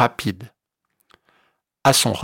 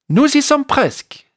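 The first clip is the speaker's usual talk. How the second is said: small bird voice; 11 semitones higher than usual. Acted. Bird voice calls out.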